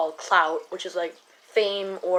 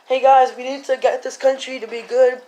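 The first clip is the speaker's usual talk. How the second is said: higher, exaggerated mocking imitation; mocking a politician; 4 semitones higher, 6 dB louder than usual. Making fun of a dropped-out candidate, mocking imitation. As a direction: make fun of how he talks. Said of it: "always talking like this, like that".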